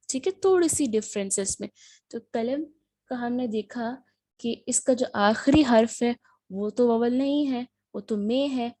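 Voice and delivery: ordinary delivery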